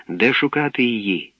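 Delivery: ordinary delivery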